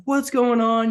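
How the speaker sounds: panicked voice on phone. Retold or imitated.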